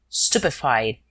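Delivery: plain reading voice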